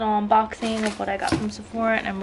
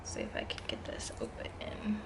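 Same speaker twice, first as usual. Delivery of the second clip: whisper; 11 semitones lower, 15 dB quieter than usual. Real, not acted. Quiet aside while opening package.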